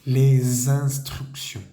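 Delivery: Les instructions said slowly, the S buzzing as Z into instructions; slow teacher model of Les instructions; liaison demo. Shown as a demonstration. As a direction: drag out les instructions with a clear Z link. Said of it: "Vamos a hacer vibrar esa S".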